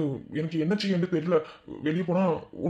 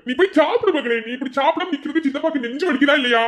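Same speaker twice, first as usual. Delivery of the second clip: loud, high-pitched scolding uncle; 10 semitones higher, 6 dB louder than usual. Acted. Uncle loudly scolding his niece to go eat. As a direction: bark at her to go eat.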